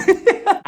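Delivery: short loud high laugh. Real, not acted. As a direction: burst out laughing. Laughing at own joke.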